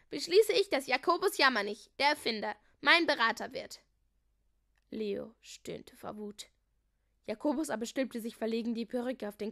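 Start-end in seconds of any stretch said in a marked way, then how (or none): 0.1-3.8 loud, emphatic decree; royal proclamation. A narrator's reading. Harry appointing his adviser, loud ceremonial announcement. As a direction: proclaim each title to the court.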